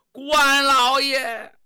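drawn-out loud cry to the heavens; desperate call. Acted. Hero calling his idol for help, drawn-out loud cry. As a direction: call out to Lord Guan, slow and loud.

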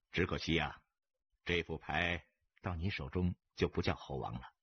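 understated scornful regret; cool mockery. A narrator's reading. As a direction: needle him calmly, deep in pitch. Stranger coolly mocks gambler's hand.

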